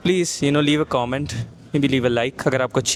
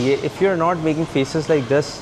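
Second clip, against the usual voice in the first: usual speaking voice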